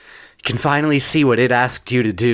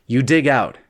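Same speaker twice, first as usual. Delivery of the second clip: ordinary delivery, nothing marked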